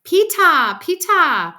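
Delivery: put-on high, very loud small-child call; little boy hollering a name; toddler calling out. A narrator's reading. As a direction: call your brother with babyish pronunciation. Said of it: "That's how he says my name".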